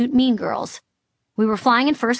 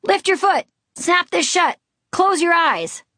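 high, urgent backstage orders; shouted directions; 10 semitones higher, 3 dB louder than usual. A narrator's reading. Costume crew to actor mid quick change, shouted directions. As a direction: call out each command sharply. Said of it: "as they shouted".

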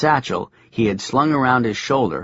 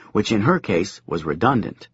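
ordinary delivery, nothing marked

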